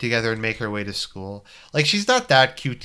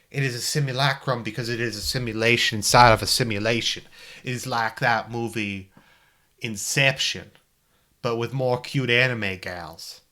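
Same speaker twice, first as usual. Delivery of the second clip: put-on old-school Virginia accent. Commenting on the self-referential game, Virginia accent. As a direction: hold forth like a bad Civil War movie Virginian. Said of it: "old school Virginia".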